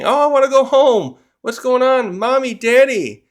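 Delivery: put-on high, loud, scared child crying for parents; panicked child. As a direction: wail for mommy and daddy in fear. Kids start panicking.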